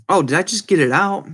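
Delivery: usual speaking voice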